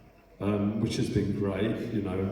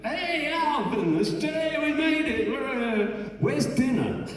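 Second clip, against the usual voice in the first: arriving friend's call. Retold or imitated. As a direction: imitate a friend cheering as he arrives.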